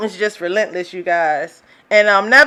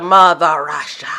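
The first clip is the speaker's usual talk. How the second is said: low mock mother Russia; 6 semitones lower than usual. Vlogger mocking Russia, low ominous voice.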